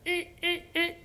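little high, soft "eh" blips; high imitated sinking noise. Father mimicking his son's grades slipping, high squeaky blips. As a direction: squeak out short, quiet high notes.

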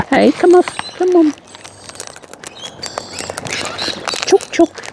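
high sing-song chook call; calling chickens. Real, not acted. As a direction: coax the hens in a high voice.

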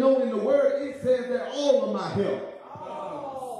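high, ringing sermon climax over music; whooping preacher voice; preaching at full force. Acted. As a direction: lift it to a shouted church climax.

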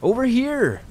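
high-pitched shouted call; yelling back. A narrator's reading. Lost hunter answering a call in the woods, high yell. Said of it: "I yelled back".

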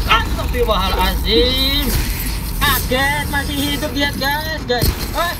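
startled yelling, high and loud; scared horror game streamer. Real, not acted. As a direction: genuine jumpscare shriek. Jumpscare, enemy still alive.

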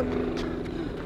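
quick, soft, whispered menace; whispered threat. Acted. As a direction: whisper it fast, creepily. Same words, whispered quickly.

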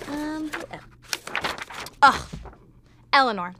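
usual speaking voice

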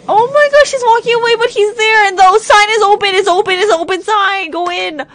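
cheering them on; excited urging. Real, not acted. Urging characters on screen.

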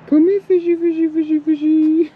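high sing-song coaxing call; talking to fish. Pretend. Calling fish to bite.

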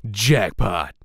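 "Jackpot!" in a crisp British accent, loud and punchy. Shown as a demonstration. Voice actor does Dante's line in a British accent. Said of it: "here is the regular British accent".

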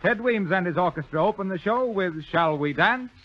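ordinary talk, nothing marked

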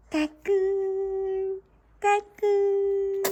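slow, loud sing-song peekaboo. Real, not acted. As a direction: stretch out the peekaboo. Peekaboo with baby.

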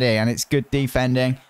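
normal talking voice

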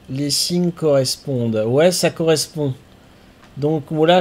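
ordinary delivery

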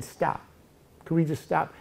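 softly pleading as a regretful Job. Acted. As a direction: beg quietly for it to end. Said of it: "Job is having, having regret".

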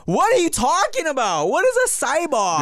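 high-pitched exasperated questioning; baffled parents. Retold or imitated. Parents staring at their son, baffled and exasperated. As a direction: imitate bewildered parents, voice up high.

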